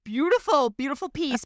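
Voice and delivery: high, teary praise of the story. Real, not acted. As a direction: praise it while fighting tears. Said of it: "I'm tearing up".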